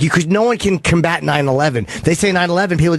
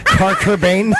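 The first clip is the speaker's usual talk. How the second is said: laughing, high mimic of her Spanish accent; mimicking Spanish accent; 5 semitones higher, much slower than usual. Co-host teasing the guest about Kurt Cobain, mock Spanish accent. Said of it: "It's my Spanish accent".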